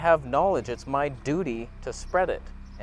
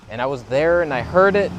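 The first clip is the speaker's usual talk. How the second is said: much louder, pained telling; anguished emphasis; 10 dB louder than usual. Real, not acted. Recalling the burned bird, loud with anguish. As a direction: push it out like a wound reopening. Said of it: "That haunts me to this day".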